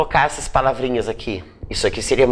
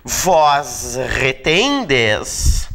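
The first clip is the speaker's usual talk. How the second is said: very slow, syllables stretched; slow conjugation, word by word; drawn-out dictation; much slower than usual. Shown as a demonstration. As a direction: stretch each word as if writing it out. Writing out the conjugation on board.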